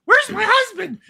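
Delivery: very high, loud imitation of a woman; shrieky put-on wife voice; wife in falsetto. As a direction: squeal it in mock falsetto. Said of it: "then she comes out and goes".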